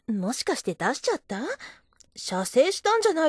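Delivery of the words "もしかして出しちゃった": normal talking voice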